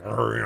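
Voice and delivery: low, short mock dog growl. Hungry man joking about eating steak like a dog, mock growl. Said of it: "eat it like a dog".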